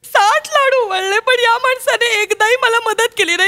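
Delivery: hammed-up weepy whine; tearful complaint. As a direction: lament your thankless toil, near tears. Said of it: "माऊलीच्या डोळ्यात अश्रू आले रे".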